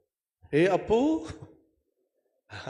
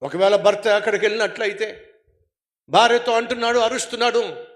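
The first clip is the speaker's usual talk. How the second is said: loud, driving sermon voice, raised all through; fiery preaching; 11 semitones higher, 13 dB louder than usual. Acted. Preacher warning the congregation about hell, full-force preaching.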